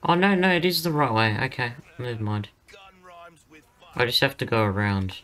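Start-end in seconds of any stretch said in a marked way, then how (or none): 0.1-1.4 surprised. Real, not acted. Realizes route was right.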